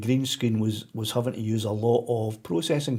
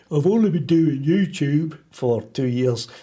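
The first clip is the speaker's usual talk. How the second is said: badly faked voice of somebody else, slowed and louder; terrible impersonation; 3 semitones higher, 5 dB louder than usual. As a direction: do a hammy, drawn-out bad impersonation. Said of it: "That was a terrible impersonation of somebody".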